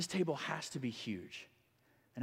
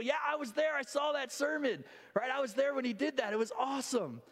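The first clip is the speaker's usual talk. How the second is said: much higher, eager cry; excited cheer; 9 semitones higher than usual. Acted. Listeners at the table cheer John Wesley's sermon, excited. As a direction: burst out like a proud witness.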